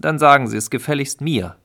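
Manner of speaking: jealous husband ordering the neighbour to deal only with him; gruff demand. Acted. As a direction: demand it bluntly, no politeness. Hostile husband warns neighbour.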